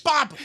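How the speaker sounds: high, sharp mouth-made bop; cartoon bop sound effect. Barney hits Betty, sharp mouth-made bop.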